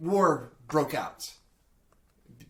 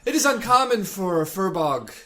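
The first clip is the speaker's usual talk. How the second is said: lightly voiced Firbolg, a bit higher and louder; in-character explanation; 6 semitones higher, 8 dB louder than usual. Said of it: "should I do this in character voice".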